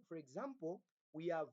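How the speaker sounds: ordinary talk, nothing marked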